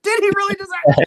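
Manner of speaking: loud, very high mock-panicked disbelief; incredulous inner scream. Engineer panicking inwardly at the guitarist's question, loud incredulous squeal. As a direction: blow it up into a squealed shout. Same thought repeated, escalating.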